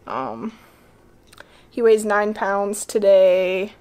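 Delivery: ordinary talk, nothing marked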